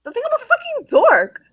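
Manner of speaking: shrill-free outrage, way up high; outraged teen. Acted. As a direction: whine it like a horrified teenager. Teen refusing glasses, outraged.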